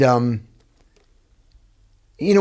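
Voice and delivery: ordinary talk, nothing marked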